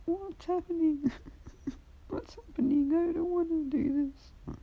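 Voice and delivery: put-on high, slow, teary little boy voice; crying child. Frightened boy crying to the hero. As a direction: whimper it like a scared small kid. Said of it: "he's kinda crying a little bit".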